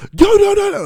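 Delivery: high-pitched yelling outburst; mock screaming. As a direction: scream it like someone forcing a point across. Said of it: "so you screaming and yelling".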